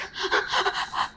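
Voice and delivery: long quiet unvoiced sigh of release; soft exhale as a child settles after crying; settling breath. Shown as a demonstration. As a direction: show the calming breath out. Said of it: "She started to breathe like this".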